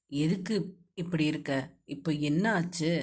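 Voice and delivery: low, soft male voice asking; concerned young man. A narrator's reading. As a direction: ask her gently in a low male voice. Hero asks the upset girl.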